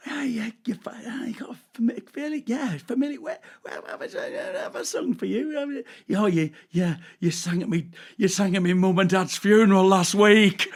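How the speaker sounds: very high, stammering bluff building to a loud finish; fake recognition. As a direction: pretend to place a face, groping in a squeaky voice. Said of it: "what I actually say is".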